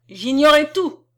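loud, high outraged cry; sudden forceful outburst; shouted. A narrator's reading. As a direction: shout it in self-defence. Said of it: "cria-t-il en frappant du pied".

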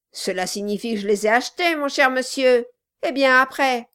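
loud, fast, sneering dwarf; mock him with false sweetness. A narrator's reading. Dwarf mocking.

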